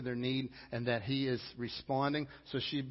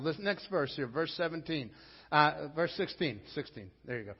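normal talking voice

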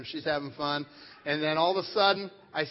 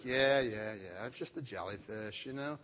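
low, soft, unimpressed brush-off; unbothered, low-key dismissal; dismissive dad; 7 semitones lower, 6 dB quieter than usual. Retold or imitated. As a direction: wave it away under your voice. Unconcerned dad brushing off the sting.

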